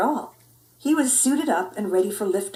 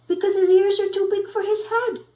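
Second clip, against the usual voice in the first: put-on much higher, bratty girl voice; teasing little sister; 11 semitones higher than usual. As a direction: tease him in a high little-girl voice. Jezebel teasing, high voice.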